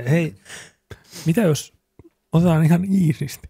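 put-on high, sing-song coaxing to the kids; mock gentle parent.

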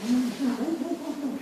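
gushing greeting. Acted. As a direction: greet him, thrilled and fawning.

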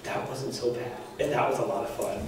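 hushed private aside; soft, secretly pleased admission; inner thought. Retold or imitated. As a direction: drop the voice as if thinking to yourself. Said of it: "thinking on the inside".

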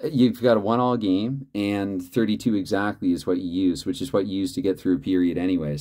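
usual speaking voice

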